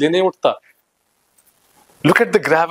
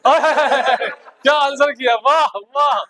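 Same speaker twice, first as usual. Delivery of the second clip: laughing delight; 7 semitones higher than usual. Real, not acted. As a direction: crack up at the answer. Teacher delighted at funny answer. (हँसते हुए तारीफ)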